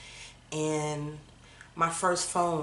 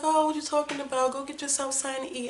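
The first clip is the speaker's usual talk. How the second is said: mock jovial father; 9 semitones higher, faster than usual. Father urging his daughter to eat, imitated jovial. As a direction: brush it off like the kindest father. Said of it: "He was like".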